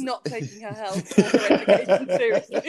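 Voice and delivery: mock yourself while laughing; laughing self-mockery. Real, not acted. Host joking about her younger self, laughing. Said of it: "joking self-mockery".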